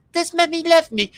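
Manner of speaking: put-on high, small child asking. Child asking about mummy in a high small voice. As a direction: sound like a little kid.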